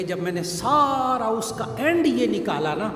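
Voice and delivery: ordinary talk, nothing marked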